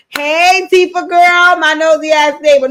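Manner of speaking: loud, high shout to the neighbor; hollering across at someone; calling out. Real, not acted. Calling out loud to her nosy neighbor.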